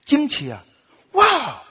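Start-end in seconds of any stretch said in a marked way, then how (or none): 1.1-1.6 high, excited wow with a laugh, imitating the pastor; mock astonishment at the tongues; amazed pastor. Pastor praising the speaker's tongues, mock-amazed high exclamation. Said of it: "那个牧师好 惊奇啊".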